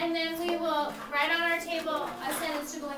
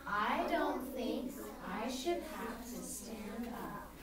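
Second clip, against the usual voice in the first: quiet, low and unhurried; slow, softly spoken calm refusal; calm Rosa; 5 semitones lower, 8 dB quieter, slower than usual. Acted. Teacher models Rosa's reply for the class, calmly. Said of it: "now remember, she's calm".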